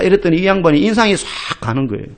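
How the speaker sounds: ordinary delivery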